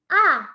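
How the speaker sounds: short, loud A dropping from high; fourth tone, falling. Shown as a demonstration. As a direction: drop A sharply from the top. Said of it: "nada keempat itu turun". (A pendek dengan nada turun)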